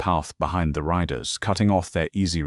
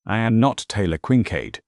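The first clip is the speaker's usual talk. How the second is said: louder defiant call, stress on not; 5 semitones higher, 5 dB louder than usual. A narrator's reading. Taylor calls out to the men, louder and defiant. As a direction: call it across the yard. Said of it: "she called out".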